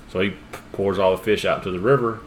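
ordinary delivery

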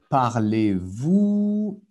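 parlez-vous stretched out very slowly; teacher slowing parlez-vous for the class; slow model pronunciation. Shown as a demonstration. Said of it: "donc on va faire un tour".

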